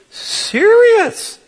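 very high, shocked squeak; incredulous. Retold or imitated. Passenger appalled by seatmate, high incredulous squeak. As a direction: squeak out disbelief. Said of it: "I'm just like".

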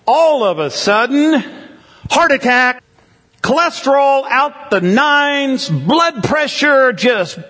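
preacher's dramatic crescendo; dramatic alarm. Acted. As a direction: build it like sudden catastrophe. Dramatic health crisis build.

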